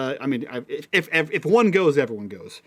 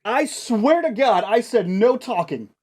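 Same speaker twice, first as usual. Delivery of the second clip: raised strict scolding; stern vice principal; 7 semitones higher than usual. Acted. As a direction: scold the class from afar. GM voices vice principal yelling.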